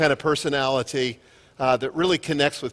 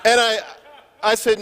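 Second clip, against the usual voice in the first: usual speaking voice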